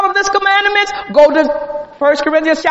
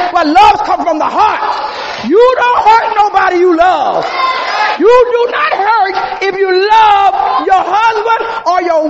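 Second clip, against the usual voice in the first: raised, emphatic preacher's cry; full-force preaching; 3 semitones higher than usual. Acted. Preacher telling the congregation what love is not, full-force preaching. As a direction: drive each point home to the congregation.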